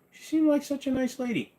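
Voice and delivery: very high falsetto lament; mock mourning. Streamer joking that a viewer's woman got cooked, mock mourning. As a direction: squeak out a pretend sorrow.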